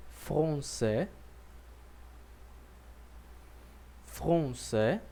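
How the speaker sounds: français, final s silent, slow with pauses; français said twice for the class to repeat; model for repeating. Shown as a demonstration. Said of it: "repita comigo agora".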